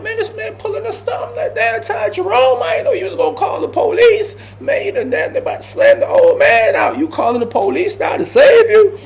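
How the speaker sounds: mock him between laughs; laughing mockery. Teasing Ty for calling the police, laughing high. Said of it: "so I'm like".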